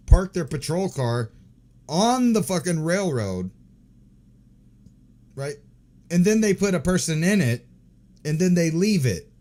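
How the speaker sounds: loud, furious rant at the cops; angry rant. Real, not acted. Streamer rants at cops who parked on railroad, angry. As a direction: hammer each phrase in disbelief.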